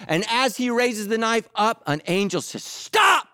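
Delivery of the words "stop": a sudden high shouted stop; urgent cry. Acted. Angel calling to Abraham raising the knife, a sharp high shout. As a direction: halt him mid-swing.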